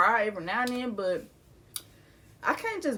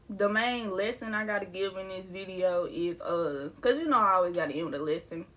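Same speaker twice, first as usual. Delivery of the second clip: ordinary talk, nothing marked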